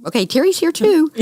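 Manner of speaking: high, hammed-up announcement; mock greeting. Nurses announcing her at chemo, high mock call. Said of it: "nurses greeting joke".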